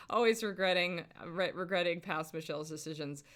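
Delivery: ordinary delivery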